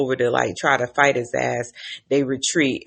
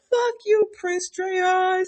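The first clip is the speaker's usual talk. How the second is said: high put-on voice cursing; mock defiant captive; 4 dB quieter, slower than usual. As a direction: spit it out in a high hammy voice. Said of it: "says the man".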